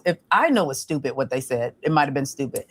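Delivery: usual speaking voice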